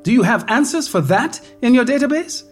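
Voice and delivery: high, rising panic, fast at the end; anxious pleading. Acted. Woman to virtual doctor about losing everything, high anxious pleading. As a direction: fire the fears at him one after another.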